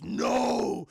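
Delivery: hammed-up demon voice. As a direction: intone it like a commanding demon. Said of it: "turns into a fucking demon and goes".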